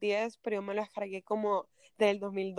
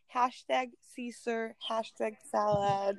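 slow hashtag caption voice; much slower than usual. Retold or imitated. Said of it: "Y yo como que".

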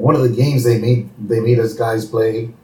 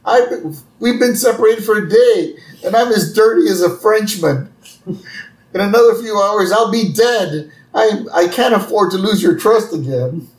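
much higher, louder, desperate Homer; Homer pleading; 10 semitones higher, 3 dB louder than usual. Retold or imitated. Homer begging Marge to trust him again. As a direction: beg her in a high, urgent voice. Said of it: "And Homer's like".

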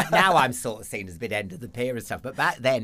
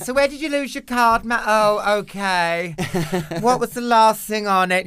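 a much higher, louder, snooty call-centre voice; judgmental; 10 semitones higher, 9 dB louder than usual. Retold or imitated. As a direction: look down on the caller while taking the details. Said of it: "You're being really judgmental".

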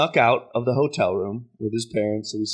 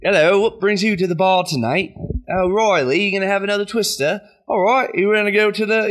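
bad British accent, pitched a bit higher; fake British accent; 6 semitones higher than usual. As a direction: ham up a clumsy fake British accent at the bar. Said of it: "talk in Australian or, like, British accents".

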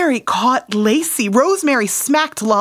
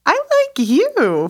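higher and drawn-out; high, slow echo; 9 semitones higher, slower than usual. Acted. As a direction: sing it back high and slow. Echoed confession, higher and slower.

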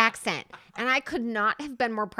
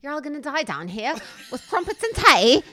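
loud mock British, nearly Australian; hammed-up British that lands Australian; botched British accent; 4 semitones higher, 8 dB louder than usual. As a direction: proclaim it in a badly faked British accent. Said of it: "This is so close to Australian".

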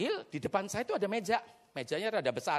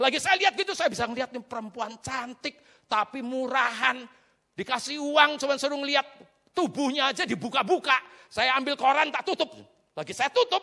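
loud, raised storytelling from the pulpit; high, emphatic sermon delivery; preaching; 7 semitones higher, 6 dB louder than usual. Real, not acted. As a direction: tell it forcefully to the congregation. Preaches at full force.

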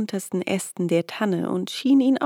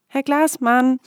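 higher, louder calling out; 6 semitones higher, 7 dB louder than usual. A narrator's reading. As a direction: call his name urgently. Louder repeat of call.

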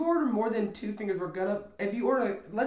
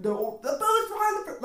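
mock screaming lady; 11 semitones higher than usual. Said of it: "some lady's screaming at me".

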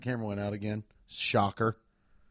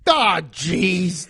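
put-on louder old-time factory worker groan; 3 semitones higher, 6 dB louder than usual. Co-host mimicking someone messing up at the factory, factory worker. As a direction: put on the worker who botched the job. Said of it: "something somebody says when they mess up".

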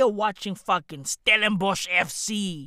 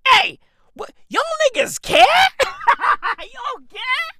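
high squeaky mock outrage, much higher; mocking disbelief; 14 semitones higher, 4 dB louder than usual. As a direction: squeal it like you can't believe they care. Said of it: "I was like".

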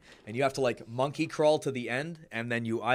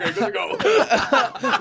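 mock instructor; 10 semitones higher, 8 dB louder, slower than usual.

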